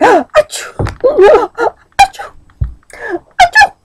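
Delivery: loud high mock sneezes; big sneezes. A narrator's reading. Grocer sneezing in the pepper, loud sneezes. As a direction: sneeze hard three times. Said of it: "He was sneezing".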